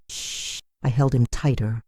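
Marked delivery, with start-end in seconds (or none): 0.1-0.6 whispered hush, barely there; soft shush. Acted. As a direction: hush him gently in a whisper. Mother hushing crying son.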